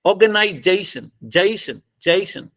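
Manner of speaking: "जेशन" with strong "जे" and light, falling "शन"; stress on जे. Shown as a demonstration. Teacher repeating for students, "जे" strongest and "शन" light. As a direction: hit "जे", let "शन" drop in "organisation". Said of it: "जई सबसे ज्यादा, शन को हल्का बोलना है".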